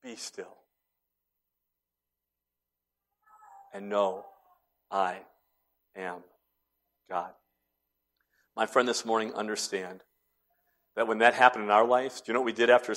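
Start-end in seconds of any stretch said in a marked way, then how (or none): 0.0-7.3 very soft, low, word by word; still small voice. Shown as a demonstration. Preacher voicing God's gentle whisper, hushed and slow. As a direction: whisper it with long pauses. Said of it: "a still small voice that said".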